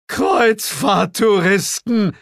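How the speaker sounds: slow, high, loud cry of horror; a drawn-out melodramatic shriek of dread; mock horror reveal. A narrator's reading. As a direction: wail the word like the worst monster imaginable.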